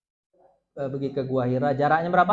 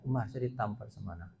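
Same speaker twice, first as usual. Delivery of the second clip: quiet complaint; 8 semitones lower, 11 dB quieter than usual. Retold or imitated. Commoner complains to Umar.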